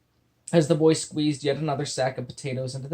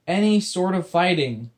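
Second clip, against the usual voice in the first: stern; 5 semitones higher, 6 dB louder than usual. Acted. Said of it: "William clarified sternly".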